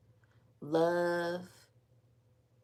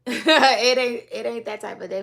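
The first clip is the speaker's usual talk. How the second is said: laughing; 6 semitones higher, 14 dB louder than usual. Real, not acted. Laughs at own impression.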